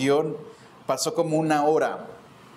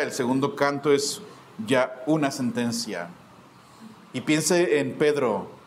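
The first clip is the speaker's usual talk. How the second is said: preaching at full force; 4 semitones lower, 3 dB louder than usual. Acted. Preacher to congregation on Peter's fall, loud and heavy. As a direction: drive home Peter's ruin with weight.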